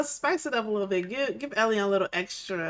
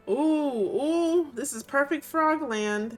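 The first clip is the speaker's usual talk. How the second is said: delighted gasp; 6 semitones higher, 5 dB louder, much slower than usual. Real, not acted. Excited at finding frog pond.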